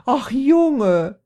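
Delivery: very high, loud motherly exclamation; mother crying out. A narrator's reading. Mother rushes to fallen son, high loud cry. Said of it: "rief sie".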